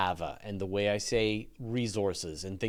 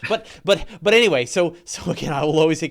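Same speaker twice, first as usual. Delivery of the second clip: loud and fast; 4 semitones higher, 10 dB louder than usual. Real, not acted. Loud laughing apology.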